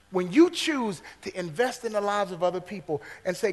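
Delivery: ordinary delivery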